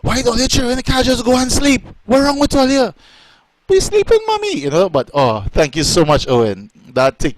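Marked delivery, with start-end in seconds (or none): 0.0-2.9 high-pitched imitation of a scolding mother; scolding mom. Mom telling noisy children to sleep, imitated scolding. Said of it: "my mom would be like". 3.7-4.6 put-on very high little-kid voice; imitated child. Children answering mom from the dark, imitated child voice.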